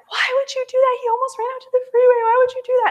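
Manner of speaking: high, distraught wail at husband; imitating herself bawling and freaking out; retold bawling. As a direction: wail the accusation, high and upset. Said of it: "and I'm bawling".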